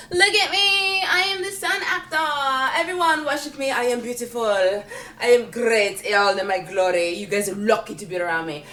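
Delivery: preen like the center of the universe; vain star actor. Retold or imitated. Vain actor on set demanding worship, hammed-up. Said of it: "they're like".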